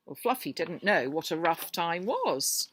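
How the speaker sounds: ordinary delivery, nothing marked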